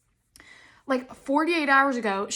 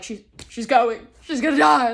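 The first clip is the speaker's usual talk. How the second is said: imitating dramatic dad; 7 dB louder than usual. Dramatic dad giving up on the dog, mock mournful. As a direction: ham up dad's gloom. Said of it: "He's like".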